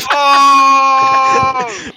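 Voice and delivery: loud, high shocked cry; shocked shout. Real, not acted. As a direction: yell it out in shock. Reacts to the insult.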